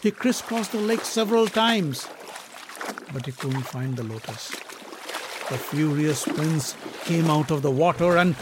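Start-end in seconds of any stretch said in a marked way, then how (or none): 5.5-8.4 rising to a booming climax; building loud narration. A narrator's reading. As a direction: swell into a roar of rage. Narrating Bhima's rage.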